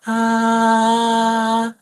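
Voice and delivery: sustained high "ah"; high pitch. Shown as a demonstration. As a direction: sing "ah" on a high note. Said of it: "we call that a high pitch".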